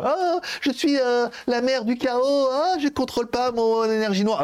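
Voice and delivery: high, slow, breathy mock of a tormented chosen girl; mock dramatic heroine. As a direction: ham up a whiny heroine losing control. Mocking the series heroine.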